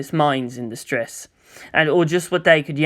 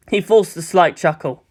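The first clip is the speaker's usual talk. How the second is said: usual speaking voice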